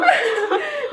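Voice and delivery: real high-pitched laughter; high giggle. Real, not acted. Wife laughs at fooled partner, high giggle. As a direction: burst out giggling after the reveal.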